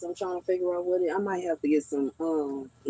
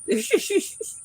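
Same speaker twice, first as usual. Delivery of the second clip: high giggle. Real, not acted. Giggle after thanking viewer.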